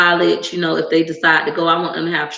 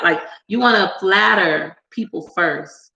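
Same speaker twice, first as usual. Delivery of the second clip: ordinary talk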